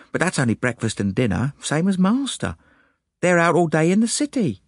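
put-on high servant-girl voice, easing as she explains; chatty maid, mildly grumbling. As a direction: grumble about the mistress to a visitor. Annie describes the household.

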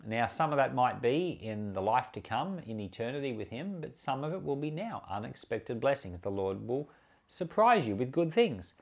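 normal talking voice

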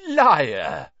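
sudden loud bark of Liar; loud cruel accusation; cruel shout. A narrator's reading. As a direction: snap at him, cruelly amused. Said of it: "said the first voice again, the cruel amusement".

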